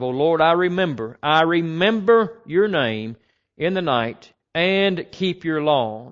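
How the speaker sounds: loud, measured psalm reading; scripture reading. Acted. Preacher reading Psalm 119 to the congregation, loud and measured. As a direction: proclaim each verse slowly.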